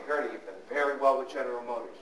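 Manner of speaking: low, soft mock-serious advisor; advisor counselling client. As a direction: lay it out quietly and soberly. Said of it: "So now I say".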